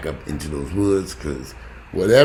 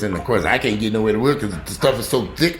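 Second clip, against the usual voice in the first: normal talking voice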